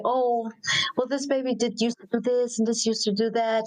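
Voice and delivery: high, quick doting parent voice; mimicking a fond parent. Parent gushing to friends about her baby, doting and quick. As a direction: gush like a proud mother about her baby. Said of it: "you'll say".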